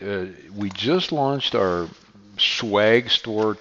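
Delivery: usual speaking voice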